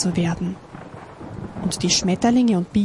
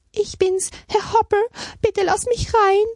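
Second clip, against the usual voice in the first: high, pleading hare voice; whining hare; 13 semitones higher than usual. A narrator's reading. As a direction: wail high, begging to be let in. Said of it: "jammerte der Hase". (jammernde, hohe Hasenstimme)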